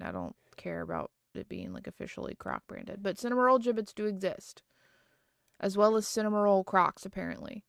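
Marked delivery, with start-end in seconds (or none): 0.0-3.0 low, near-whispered mumble; muttered aside. Real, not acted. Brushing off the Croc branding to herself, muttered. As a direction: say it to yourself, barely voiced.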